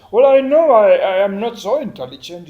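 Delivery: high and loud, a meek man giving in; sheepish man. Retold or imitated. A proud man quoted admitting he is not clever, sheepish. Man humbly answering a lady preacher.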